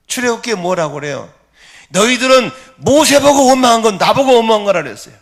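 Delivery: high, loud pulpit exclamations; impassioned preaching. Real, not acted. Preacher recounting Israel's grumbling in the wilderness, impassioned.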